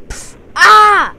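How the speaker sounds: high, loud yelp of pain. Acted. Jeremy getting stabbed by the zombie, sharp pained yelp. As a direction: cry out as the blow lands.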